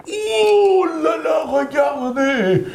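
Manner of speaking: put-on excited story narration for kids; storytelling. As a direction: tell it like a wide-eyed tale for children. Père Noël raconte le conte. (conteur enthousiaste pour enfants)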